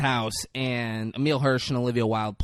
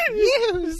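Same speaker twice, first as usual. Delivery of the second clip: very high-pitched mock delight; giddy imitation cheer; excited squeal. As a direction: squeal with hammed-up glee. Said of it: "So excited for the spritzer".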